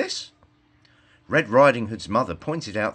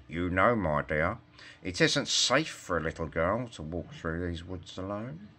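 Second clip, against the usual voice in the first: wolf voice; 3 semitones lower, 7 dB quieter than usual. A narrator's reading. Wolf speaks to the girl.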